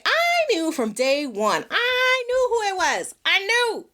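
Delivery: high, drawn-out insistence; dramatic storyteller's conviction; emphatic certainty. Real, not acted. Insists she knew the culprit.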